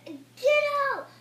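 high, loud shout; shouted get out. Acted. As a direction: yell at them to leave. Shouting at the robot.

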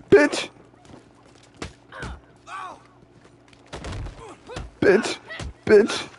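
sharp high shout; high yelled insult. Acted. Mid-fight insulting an attacker, high yell. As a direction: spit it out in a squeal.

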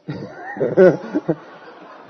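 amused burst of laughter. Real, not acted. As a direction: laugh out loud at your own story. Amused by own story.

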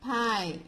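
PIE read as "p-eye", second vowel silent; a clear, isolated PIE ending on a long "eye"; long I sound. Shown as a demonstration. Teacher reads the example to children, long I. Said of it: "So this is read as".

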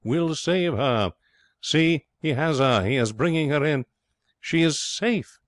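high, drawn-out exclamations; thrilled running commentary on a rescue; excited onlooker. Acted. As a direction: call it like a delighted spectator.

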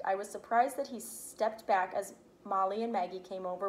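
plain reading voice

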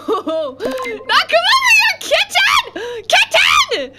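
high laughing yell; laughing shout. Real, not acted. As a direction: shriek through laughter. Laughing panicked yelling.

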